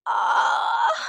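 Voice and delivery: high, breathy cry of pain; sharp gasp of sudden belly pain. Acted. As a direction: pregnant daughter-in-law groaning in pain. Pregnant bahu in pain.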